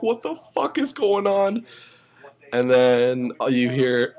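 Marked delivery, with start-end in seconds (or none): 0.0-1.7 high-pitched retold freak-out; baffled, high-pitched outburst. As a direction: relive the panic, voice shooting way up. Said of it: "I was freaking out".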